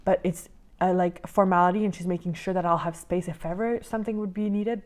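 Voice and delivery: ordinary delivery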